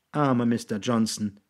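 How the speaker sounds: usual speaking voice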